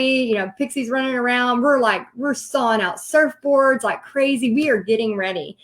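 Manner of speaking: usual speaking voice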